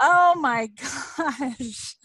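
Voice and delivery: very slow, choked-up gasp of feeling; real tearful wonder at the crowd; overcome with emotion. Real, not acted. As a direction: let the emotion catch in the throat. Moved by the big live turnout.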